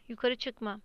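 plain reading voice

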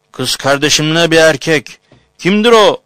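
rising outraged shout; shocked shout. Acted. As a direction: shout it as you burst in. Brother finds man with sister.

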